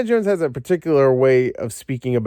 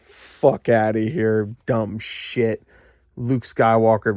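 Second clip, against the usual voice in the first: usual speaking voice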